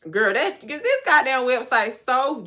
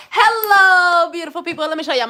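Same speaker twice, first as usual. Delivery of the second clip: high, loud hello; bright loud greeting; 7 semitones higher, 8 dB louder than usual. Real, not acted. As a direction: sing out the hello. Greeting viewers.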